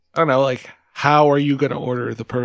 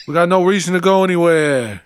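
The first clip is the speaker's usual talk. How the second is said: brash New Jersey local, a bit louder; New Jersey accent; 4 semitones higher, 4 dB louder than usual. Retold or imitated. Jersey local agreeing at the diner, New Jersey accent. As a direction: brag like a Jersey guy staying home. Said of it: "New Jersey".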